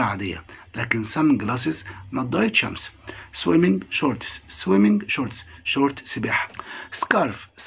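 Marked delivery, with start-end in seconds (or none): 3.3-4.4 swimming shorts drawn out slowly, each part clear; slow model word. Shown as a demonstration. Teacher to pupils, slow clear model of the word. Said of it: "repeats word, first slow model". 6.9-7.6 whispered word. Shown as a demonstration. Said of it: "vocabulary model word".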